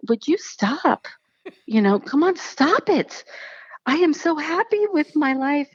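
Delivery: mock exasperation; exasperated. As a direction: wave it off, half laughing. Brushing off sister's suggestion.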